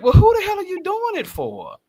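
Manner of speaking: high, loud mock disbelief; men asking women who the makeup is for, incredulous. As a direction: throw it back in disbelief. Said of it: "And we're like".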